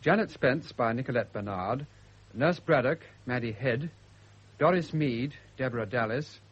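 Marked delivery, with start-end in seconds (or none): none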